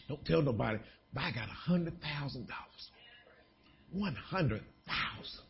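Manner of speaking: soft, secretive boast; hushed confiding. Acted. Believer confiding a big bonus to church friends, hushed. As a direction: keep it low, like a secret.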